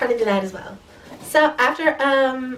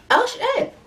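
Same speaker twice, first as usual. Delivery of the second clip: high-pitched starstruck gasp of words; surprised reaction; 13 semitones higher than usual. Retold or imitated. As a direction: retell it as a high, stunned little exclamation. Acted thrilled reaction at party.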